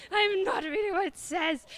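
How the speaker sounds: loud, high-pitched comic yell; mock tantrum refusal. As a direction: blow up in hammed-up stubborn protest. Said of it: "'cause I was complaining".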